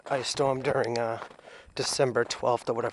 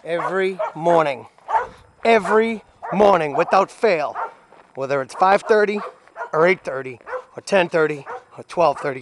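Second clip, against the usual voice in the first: loud complaint; 4 semitones higher, 10 dB louder than usual. Real, not acted. Owner griping about barking every morning, loud and emphatic. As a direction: complain loudly over the racket, stressing every part.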